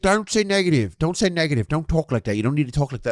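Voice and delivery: lightly voiced pleading kid; imitating a scolding kid. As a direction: mimic the kid telling him off. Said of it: "And he's like".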